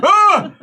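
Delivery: short, high, loud cry; acted yelp. Game master voicing the patient, acted yelp. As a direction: let out a sudden yelp.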